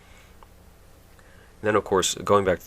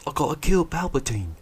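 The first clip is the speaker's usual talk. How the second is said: higher, petulant; mock Rey; 9 semitones higher than usual. Mocking Rey's change of heart, high and petulant. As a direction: declare it like a pouty kid. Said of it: "But then she says".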